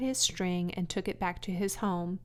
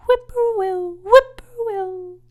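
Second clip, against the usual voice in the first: much higher, louder whistled call; birdcall; 13 semitones higher, 13 dB louder than usual. Acted. Bird calling across the road at dusk, birdlike. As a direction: sing it like a whip-poor-will.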